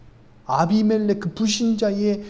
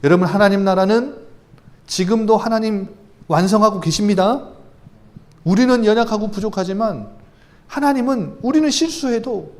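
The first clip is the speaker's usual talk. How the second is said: louder, rising preacher's voice, driving the point home; fervent preaching; 5 semitones higher, 6 dB louder than usual. Acted. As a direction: preach it out with full conviction. Preaching God's faithfulness.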